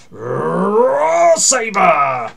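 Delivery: long rolled growl swelling into a high, loud yell; angry battle roar. Retold or imitated. Imitating the show's robot getting angry, roared long and loud. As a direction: roar it out slowly like an enraged transforming robot. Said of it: "he gets angry and he goes".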